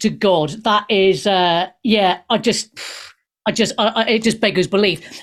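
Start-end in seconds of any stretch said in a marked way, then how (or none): none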